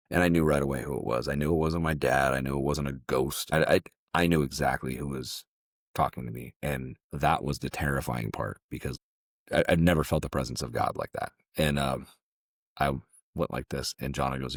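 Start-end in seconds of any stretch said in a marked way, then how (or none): none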